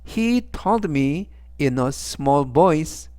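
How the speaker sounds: He told me in a small voice, very slow; slow model sentence. Shown as a demonstration. Teacher reading example sentence slowly for class. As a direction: read it out word by word. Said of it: "전체적 의미는".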